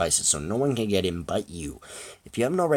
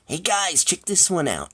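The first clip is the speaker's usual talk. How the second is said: put-on high-pitched, obnoxious boy calling out; obnoxious character voice pitched well up; 10 semitones higher, 3 dB louder, slower than usual. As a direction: call your pals over in a high, obnoxious voice. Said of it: "an obnoxious voice calls".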